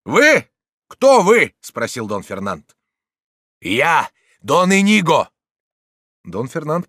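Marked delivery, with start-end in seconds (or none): 0.1-1.5 high, very loud questions; challenging shout. A narrator's reading. Fighter challenges unseen speaker below. 3.6-5.3 high, loud announcement; proclaiming shout. A narrator's reading. Judge shouts his name upstairs.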